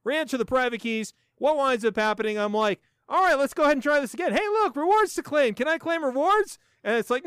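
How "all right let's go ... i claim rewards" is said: high, hurried pretend hopefulness; pitched-up, playful excitement; mock eagerness. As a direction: act out an over-keen retry. Re-enacts hopeful retry at claiming.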